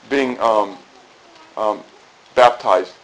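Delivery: usual speaking voice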